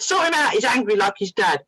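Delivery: mock-exasperated parent; retold exasperated mum. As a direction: hand him over crossly, as the mum. Retold exasperated mum dropping off son.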